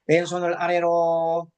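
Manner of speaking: slow, level intoned meditation instructions; low, measured meditation-leader monotone; guided breathing meditation. Acted. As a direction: lead the breath slowly, drawing out each phrase ending on a held note.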